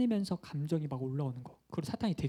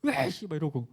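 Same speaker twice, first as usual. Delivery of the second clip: hammed-up irritated grunt; mock angry outburst; 3 semitones lower than usual. Preacher imitating a hot-tempered friend, mock angry. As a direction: play someone flaring up in a temper. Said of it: "막 화를 막 하루에도 몇 번씩 막 화내고".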